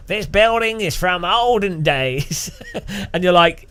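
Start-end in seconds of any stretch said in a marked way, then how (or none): 0.1-2.5 slow American tour-guide imitation; American accent. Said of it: "museum tour thing and they're like".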